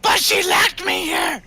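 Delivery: slow, high squeaky shout; aggrieved little creature. Acted. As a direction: press each word out in a tiny creature voice. Gitmo complains about being locked in.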